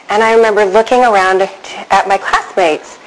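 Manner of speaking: ordinary delivery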